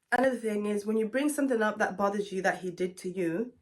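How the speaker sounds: ordinary delivery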